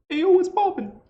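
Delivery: Jabu's voice. Shown as a demonstration. Teacher shows students how Jabu says it, high and loud. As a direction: shout it up high as the goblin. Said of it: "would actually come out like".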